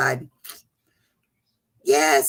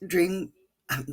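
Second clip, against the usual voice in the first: ordinary talk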